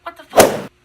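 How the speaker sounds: put-on loud alarmed child voice; shocked kid outburst. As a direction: shout it in disbelief. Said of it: "the other one's like".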